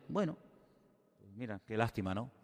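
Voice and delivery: soft, low, half-hearted commiseration; indifferent pity. Acted. Imagining the cupbearer brushing off bad news, quietly indifferent. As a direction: toss off sympathy quietly, without really caring.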